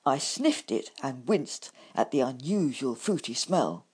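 ordinary delivery

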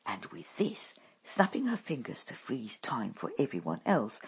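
ordinary delivery, nothing marked

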